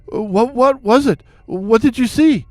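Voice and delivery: high, stammered anxious questions; sputtering. A narrator's reading. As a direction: sputter it out in alarm.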